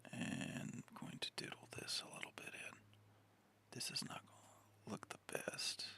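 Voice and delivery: soft, breathy whisper to herself. Real, not acted. Talking to herself while drawing, in a whisper. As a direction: murmur while concentrating on the drawing.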